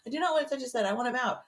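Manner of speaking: high, laughing, exasperated no; exasperated refusal. Retold or imitated. Refusing the midwife's offer to touch the head, high exasperated. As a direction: refuse it flat out, half laughing.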